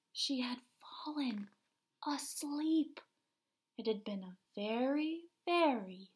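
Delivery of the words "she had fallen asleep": whispering. Acted. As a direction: whisper so she won't wake. Spider asleep, hushed.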